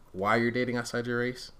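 ordinary talk

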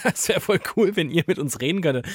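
loud and high, laughing; high excited gush. Real, not acted. Excited, laughing plea to listeners.

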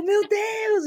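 high, overjoyed exclamation. Retold or imitated. Hearing the job offer, cries out overjoyed. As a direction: cry out in pure joy.

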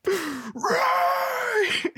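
long high shouted cry; Finn shouting Rey. Retold or imitated. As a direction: hold a dramatic shout of her name. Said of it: "throws them all the way down to his sides and goes".

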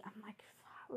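hushed scared whisper; frightened whispered curse. Retold or imitated. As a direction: breathe it out in fear.

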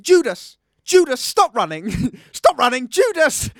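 loud, high calling out across the pool; frantic shouting mum; mother yelling. Retold or imitated. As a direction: yell the name after a running child. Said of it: "and she went".

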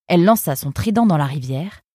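usual speaking voice

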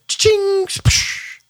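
high jingle then splash sound; imitated keys splash. Pumper mimicking keys falling into the water, jingle and splash. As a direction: make the noise of keys hitting water.

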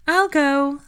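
put-on high, airy dreamy girl. A narrator's reading. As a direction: float it up dreamily. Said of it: "Luna's airy voice floated".

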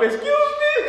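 high scandalized excuse me; mock shocked falsetto. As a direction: play a prim lady, offended. Mock shocked reaction to lyric.

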